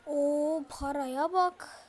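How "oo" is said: usual speaking voice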